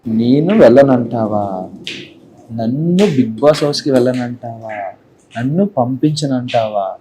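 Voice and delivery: slow, low psycho menace. Shown as a demonstration. Actor plays a psycho refused Bigg Boss entry, slow and menacing. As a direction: draw out each threatening question. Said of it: "psycho".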